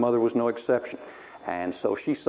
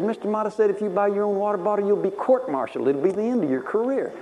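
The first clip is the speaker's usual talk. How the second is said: high-pitched, mock-dire warning; alarmed warning; 8 semitones higher than usual. Chief warns ensign about buying a water bottle, mock-alarmed. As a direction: warn him in a raised, quicker voice.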